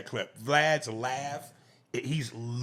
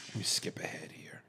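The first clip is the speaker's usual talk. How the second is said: whispered aside; 5 semitones lower, 12 dB quieter than usual. Real, not acted.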